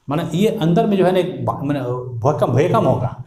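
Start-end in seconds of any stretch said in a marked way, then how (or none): none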